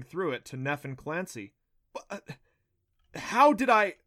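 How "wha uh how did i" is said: high, loud, startled stammer; stunned stammer. A narrator's reading. Boy caught in the glowing cage, stammering in shock. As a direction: trip over the words in shock.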